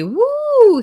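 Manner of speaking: high, loud woo of surprise; excited whoop. Real, not acted. Streamer whooping at a heat advisory, high and loud. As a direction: let out a big whoop at the news.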